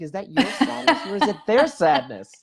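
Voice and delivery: amused retelling. Retold or imitated. Amused retold challenge to crier.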